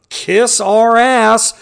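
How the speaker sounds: retold defiance, each word stretched out; defiant retort. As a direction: throw the order back slowly. Acting out the attendants' defiant retort.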